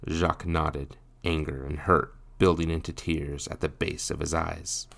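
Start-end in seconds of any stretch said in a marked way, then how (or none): none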